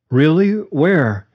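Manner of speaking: high startled questions; surprised. Acted. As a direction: jump up in pitch, startled. Leo surprised at the news.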